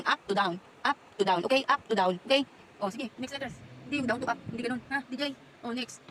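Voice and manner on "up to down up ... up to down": louder, stressing "up" each time; repeated firm instruction; firm drill. Real, not acted. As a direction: drill "Up to down" like a chant. Drilling stroke direction.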